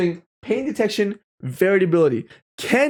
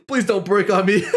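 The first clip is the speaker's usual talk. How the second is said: usual speaking voice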